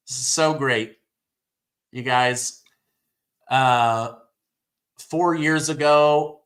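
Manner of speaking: ordinary delivery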